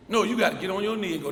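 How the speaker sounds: ordinary delivery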